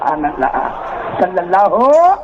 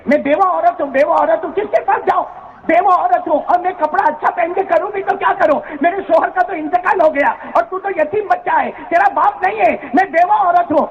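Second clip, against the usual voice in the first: high, pained plea of a poor widow; mother lamenting to her son, high and anguished; 11 semitones higher than usual. Acted. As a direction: cry out her helplessness, pitched high.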